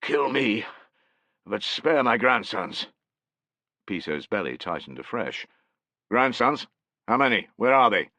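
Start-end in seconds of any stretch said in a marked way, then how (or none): 0.0-2.9 a hint of a croaky old man, higher and slow; croaking old man. A narrator's reading. 6.1-8.1 sharp, urgent, higher and louder; alarmed questions. A narrator's reading. Soldier questions anxiously.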